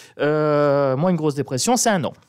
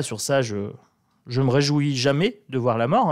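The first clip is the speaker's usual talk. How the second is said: normal talking voice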